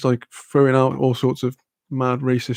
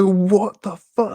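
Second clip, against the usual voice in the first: drawn-out, higher-pitched disbelief; retold outrage; 6 semitones higher, much slower than usual. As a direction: re-enact outrage, stretching the words out slowly. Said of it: "I just kicked off so hard".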